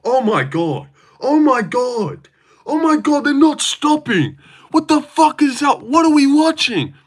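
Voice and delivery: reliving the wildest round, losing it; amazed yelling. Retold or imitated. Retelling his live reaction to the fight, in high excited disbelief. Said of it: "You're like".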